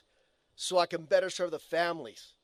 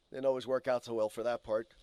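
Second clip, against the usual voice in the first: ordinary delivery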